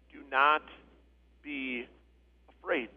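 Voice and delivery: low, hushed, with pauses between words; soft, spaced-out words of comfort; gentle reassurance. Acted. Jesus' reassurance quoted slowly.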